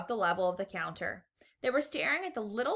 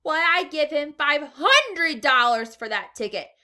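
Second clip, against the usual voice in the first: shouting; 7 semitones higher, 10 dB louder, slower than usual. A narrator's reading. Woman shouting a bid.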